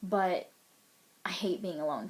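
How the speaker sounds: ordinary talk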